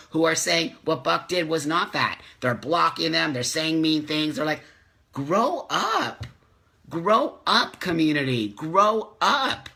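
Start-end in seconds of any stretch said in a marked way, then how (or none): 5.1-9.7 high sneering mock of trolls; mocking bullies. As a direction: toss it off like a smug troll.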